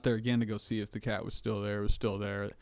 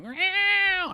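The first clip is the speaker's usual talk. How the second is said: high squeaky meow, pitched way up; cat meow imitation; 5 dB louder than usual. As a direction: mimic a hurt cat's cry. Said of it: "the sound of a fucking cat being like".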